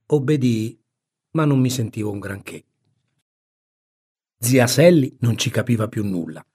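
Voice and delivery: ordinary delivery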